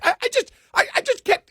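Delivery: high, loud exasperated ranting voice; Independent Bob. Retold or imitated. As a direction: sputter in disbelief. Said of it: "really trying to do the impression of him".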